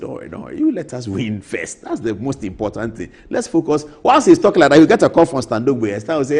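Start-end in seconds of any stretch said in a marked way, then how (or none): 0.0-3.9 quiet reassurance. Retold or imitated. Mahama calming Dampare, acted.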